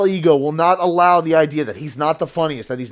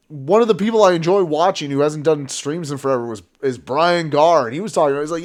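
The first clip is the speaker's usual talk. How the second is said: ordinary talk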